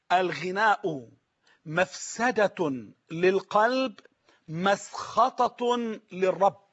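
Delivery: very slow, word by word with falls; slow solemn quote. Retold or imitated. Preacher quoting al-Dahhak to viewers, very slow and solemn. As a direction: recite the saying gravely. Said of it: "قال".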